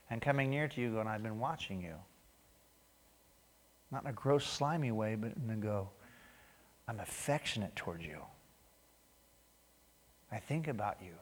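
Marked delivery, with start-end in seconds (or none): 1.1-1.9 gentle whisper. Acted. God's voice, gentle and near. 6.9-11.1 low, soft, intimate; tender hush. Acted. God speaking tenderly to each listener, soft and low.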